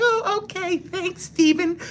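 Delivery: high mock woman's voice; mock Marge. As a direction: play the polite coworker in a squeaky falsetto. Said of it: "She's like".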